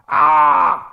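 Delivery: very loud held roar; hammed-up raging yell; mock bellow. Old man yelling at the comic, mock bellow. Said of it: "Il a gueulé".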